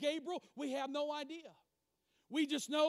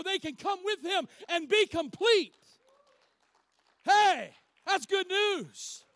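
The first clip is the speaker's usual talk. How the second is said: fervent preaching; 3 semitones higher, 6 dB louder than usual. Acted. Preacher rousing the church over good news, fervent and building. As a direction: build to a loud, triumphant climax, drawing out the last cheer.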